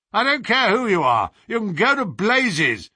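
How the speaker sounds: loud, high furious roar through a door; angry roar. Acted. Student behind the door roaring at the visitors to leave. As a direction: bellow it at the knockers to go away. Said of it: "roared the angry voice".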